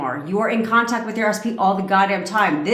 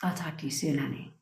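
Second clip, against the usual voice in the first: much softer, sweet parting line; soft sign-off; 6 semitones lower, 13 dB quieter than usual. Acted. Ending the affirmation to her ex, softly. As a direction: ease off into a gentle goodbye. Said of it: "I like to end it with".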